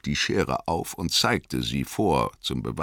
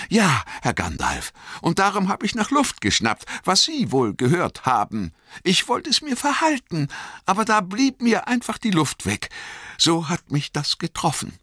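high, quick and humble servant voice; eager, deferential young gardener; high-pitched and hurried, bowing and scraping; Sam the gardener; 14 semitones higher than usual. Acted.

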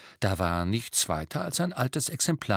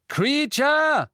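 raised voice, much higher, louder; calling out loudly; 6 dB louder than usual. A narrator's reading. Harry calling his house-elf loudly. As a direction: summon the elf with a shout. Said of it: "er hob die Stimme und rief".

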